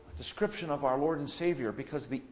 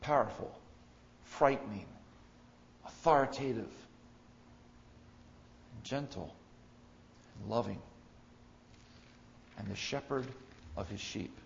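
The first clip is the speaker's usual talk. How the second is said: quiet reflective list; 3 semitones lower, 8 dB quieter than usual. Acted. Quiet restatement.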